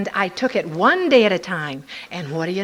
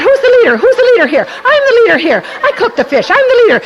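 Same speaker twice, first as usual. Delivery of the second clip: high loud crowing; high loud victorious inner voice; triumphant self-assertion; 12 semitones higher, 11 dB louder, much faster than usual. Retold or imitated. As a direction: crow it like you've won.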